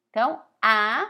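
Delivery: ordinary talk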